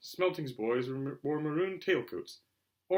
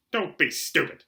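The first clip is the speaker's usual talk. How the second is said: louder sharp retort; snapped; 3 semitones higher, 7 dB louder, slower than usual. Acted. Aunt Petunia snapping at Harry, louder and sharp. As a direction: cut him down fast. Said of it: "snapped Aunt Petunia".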